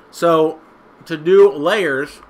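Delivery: talking while chewing gum. Real, not acted. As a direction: say it round a wad of gum. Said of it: "I'm chewing gum and I shouldn't be".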